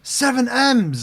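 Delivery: disbelief. Real, not acted. Incredulous at the price.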